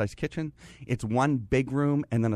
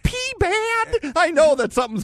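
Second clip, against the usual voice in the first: giddy excitement; 10 dB louder than usual. Retold or imitated. Excitement at the dock sale.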